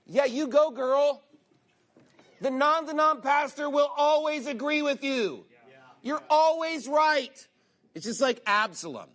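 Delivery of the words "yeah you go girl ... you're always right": high, gushing mock cheerleader; mocking flattery. Imitating a pastor flattering a woman, mock gushing. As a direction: gush like a fawning yes man.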